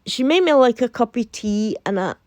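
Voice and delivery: ordinary delivery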